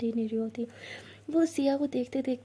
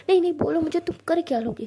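loud, higher dare; high loud taunting dare; defiant challenge; 5 semitones higher, 7 dB louder, faster than usual. Acted. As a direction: challenge her loudly.

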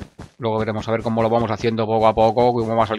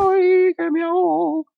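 slow falsetto wail; hammed-up high-pitched distress; mock drowning panic; 4 dB louder, slower than usual. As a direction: squeal for help in a silly high voice. Mock panic falling in water.

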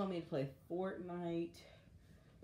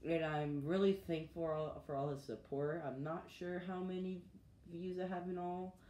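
ordinary talk

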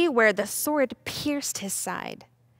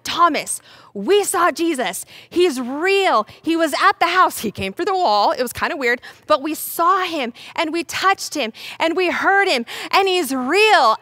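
eager, raised-pitch testimony; acted breathless enthusiasm; excited insistence; 4 semitones higher, 4 dB louder than usual. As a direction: pile up the proof to convince a doubter. Disciples excitedly convincing Thomas.

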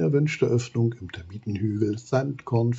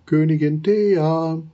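slow, loud and higher call into the hole; 7 semitones higher, 7 dB louder, slower than usual. Acted. Giraffe calls into the termite hill. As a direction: call the queen from outside her mound. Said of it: "und ruft hinein".

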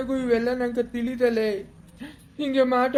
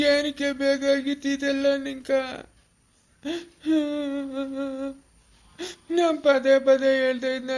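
weeping lament. Acted. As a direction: wail in fresh grief. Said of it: "ಅಳ್ಬೇಡ, ಸುಮ್ನಿರು ತಾತ".

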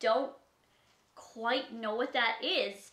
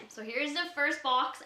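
ordinary talk, nothing marked

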